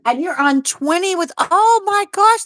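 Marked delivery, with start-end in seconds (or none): 1.4-2.4 high, drawn-out oh my gosh; thrilled by the comment; excited gasp. Real, not acted. Host reads viewer's anniversary comment, delighted high exclamation.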